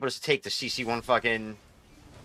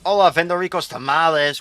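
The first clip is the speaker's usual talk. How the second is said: joking put-on Spanish accent, a touch louder; mock Spanish accent; 3 semitones higher, 5 dB louder than usual. Greeting teammates as a tamale shop, mock Spanish accent. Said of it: "Apparently that's my Spanish accent".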